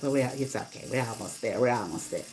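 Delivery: low, soft placating murmur; gently smooth things over, voice dropping away; kind reassurance. Retold or imitated. Kind passenger calming her companion near the destination, reassuring. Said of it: "she was kind".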